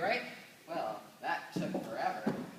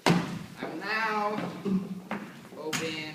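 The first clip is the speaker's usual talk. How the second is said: dramatic slow; 7 dB louder, much slower than usual. Acted. Dramatic box reveal.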